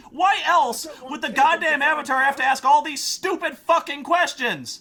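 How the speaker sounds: high, loud furious rant; furious yell. Real, not acted. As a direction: scream insults at the game. Yelling back at NPC.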